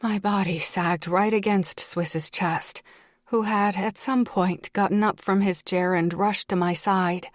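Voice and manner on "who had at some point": usual speaking voice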